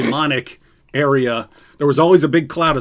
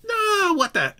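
surprised; 4 dB quieter, slower than usual. Retold or imitated. Inner realization retold.

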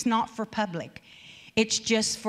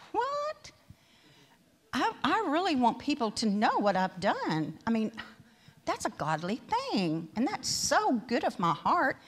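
prideful inner voice; 4 semitones higher, 5 dB quieter than usual. Retold or imitated. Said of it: "in my flesh, I was like".

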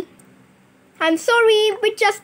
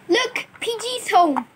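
usual speaking voice

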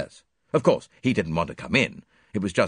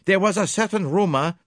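put-on higher, louder French-accented detective, pleased; French accent, smug and precise; Poirot presenting his witnesses in a French accent; 12 semitones higher, 5 dB louder than usual. A narrator's reading. Voices Poirot.

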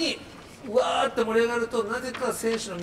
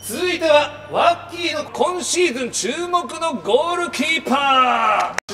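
slow, loud announcer shout; announcer call; 7 semitones higher, 5 dB louder, slower than usual. Real, not acted. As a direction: call it out like a show host. Host announcing next segment.

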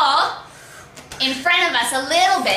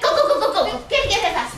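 excited cheering; 6 semitones higher, faster than usual. Real, not acted. Coach cheering kids on.

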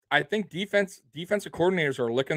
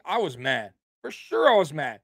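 ordinary talk

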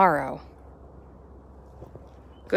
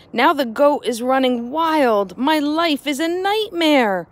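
desperate man; 7 semitones higher, 5 dB louder, slower than usual. Acted. Desperate man pleads with rabbi.

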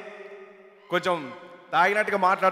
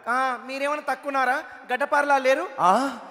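put-on higher, sassy girl retort; 4 semitones higher than usual. Girl firing back at the boys, higher and sassy. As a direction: snap back like a cheeky girl.

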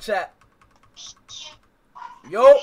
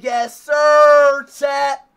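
high, louder insistence; louder repeat; 7 semitones higher, 14 dB louder, much slower than usual. Real, not acted. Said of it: "I said".

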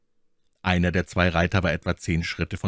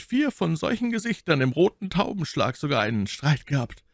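lightly voiced Athos, courteous and higher; polite musketeer; 9 semitones higher than usual. A narrator's reading. Athos apologising to the unknown officer, lightly voiced. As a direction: excuse yourself with soldierly politeness.

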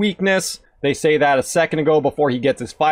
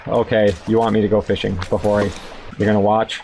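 normal talking voice

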